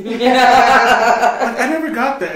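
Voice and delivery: slow, loud and high, laughing through the words. Real, not acted. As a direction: laugh it out in disbelief. Laughing at the story.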